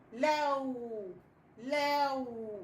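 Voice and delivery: لو stretched long, gliding into a held w; long, sustained لو; drawn-out syllable. Shown as a demonstration. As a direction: hold لو out slowly for the pupils. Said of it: "لازم تبقى طويلة".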